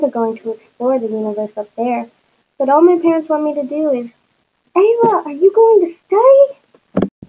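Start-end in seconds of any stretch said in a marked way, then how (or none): 4.8-7.1 higher, louder, drawn-out parent's call; nagging mother. A narrator's reading. Mother checking on Ayla's studying, nagging call.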